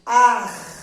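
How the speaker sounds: "Ar" said loud, the R scraped hard in the throat; emphatic demo; loud throaty ar. Shown as a demonstration. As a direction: push out "Ar" with a strong raspy R. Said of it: "ele vai arranhar minha garganta".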